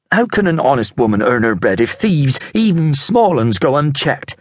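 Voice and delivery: high, pleading for support; aggrieved market woman. Acted. Apple seller appealing to the vendors, high and aggrieved. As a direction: whip up the crowd, pitched high.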